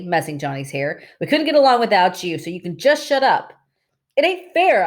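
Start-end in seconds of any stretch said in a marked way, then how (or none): 1.2-3.5 fierce. A narrator's reading. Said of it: "said fiercely". 4.1-4.9 high, loud, passionate protest; passionate cry. A narrator's reading. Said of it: "I cried passionately".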